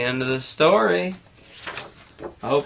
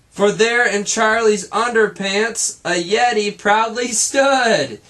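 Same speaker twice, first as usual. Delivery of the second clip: higher, louder, building wonder; excited reveal; 6 semitones higher, 4 dB louder than usual. A narrator's reading. Narrator reading a children's book, excited climax reveal. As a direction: reveal it with wide-eyed excitement.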